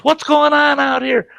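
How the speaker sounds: high, loud imitation of a mother; alarmed mom. Mom running out of the house, alarmed. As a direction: impersonate a mom hollering at squabbling kids.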